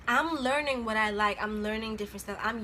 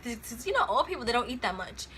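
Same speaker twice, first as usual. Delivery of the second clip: ordinary talk, nothing marked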